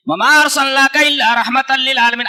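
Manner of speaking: slow melodic recitation; Quran recitation. Acted. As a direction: intone each word of the verse. Recites verse again.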